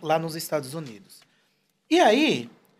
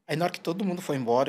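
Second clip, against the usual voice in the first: usual speaking voice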